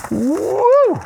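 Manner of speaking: high-pitched happy whoop; excited high woo. Real, not acted. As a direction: whoop with glee. Digging in the deep bag.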